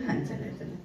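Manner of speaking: whispered, tired "let's go". Acted. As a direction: barely breathe the words out. Tired, leaving office. (कुजबुजत, थकलेलं "चला")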